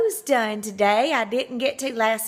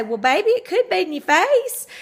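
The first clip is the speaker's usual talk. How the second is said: higher, teasing daddy joke, lightly voiced; imitating Daddy; 9 semitones higher, 4 dB louder than usual. Father teasing his daughter, lightly voiced joke. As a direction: tease her like a joking father. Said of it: "And he'd say".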